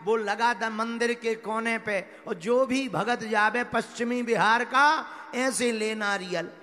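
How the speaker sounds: loud, emphatic rhythmic storytelling; rousing preacher climax, hammering the beats; full-force preaching. Acted. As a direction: belt out each phrase to the congregation. Preaches the moral loudly.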